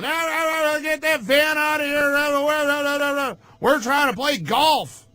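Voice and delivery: mocking high-pitched yelling, garbled and frantic; screaming old man. Ranter mimics an old golfer yelling about the van, screaming. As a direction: imitate an old man's ranting, held high. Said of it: "He just starts screaming and yelling".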